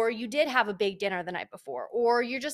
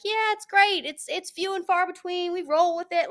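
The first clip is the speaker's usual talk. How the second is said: much higher, bright fake-happy voice; mock cheerfulness; 8 semitones higher than usual. Mocking the fake positive answer, much higher. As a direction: sell the fake it's-all-great line. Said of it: "I would be lying if I was like".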